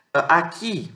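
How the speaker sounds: normal talking voice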